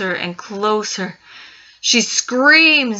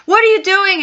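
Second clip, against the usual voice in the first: much higher, loud angry yell; yelling uncle; 11 semitones higher, 5 dB louder than usual. Acted. Uncle catches girl in his drawer, yelling. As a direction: catch her snooping and yell. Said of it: "he starts yelling".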